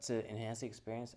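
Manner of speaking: normal talking voice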